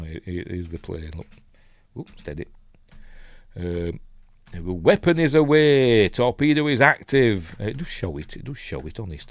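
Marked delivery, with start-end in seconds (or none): 4.7-7.6 loud, raised-pitch crew announcement; urgent film-style launch report; military call-out. Acted. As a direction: call it out like a torpedo operator. Acting aircrew launching a torpedo.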